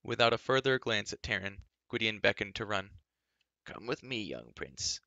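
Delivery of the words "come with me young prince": put-on old man's voice. A narrator's reading. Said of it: "his voice shook with age".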